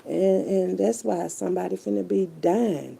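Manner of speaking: ordinary delivery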